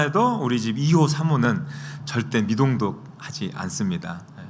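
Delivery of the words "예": usual speaking voice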